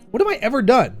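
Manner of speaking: normal talking voice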